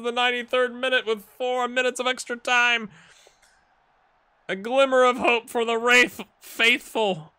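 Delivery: high, loud, drawn-out commentator cry; live play-by-play. Acted. Streamer calling a nervy last-minute clearance, excited football commentary. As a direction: call the late drama at full pitch.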